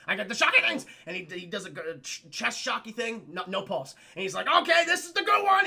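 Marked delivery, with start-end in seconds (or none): 0.1-0.8 loud, raised-pitch announcement; hammed-up doctor in a hurry; mock urgent yell. Acted excited announcement in scene. 4.5-5.6 high, very loud, hammed-up yell; imitating a frantic movie doctor; mock urgent shout. Acted doctor before second shock.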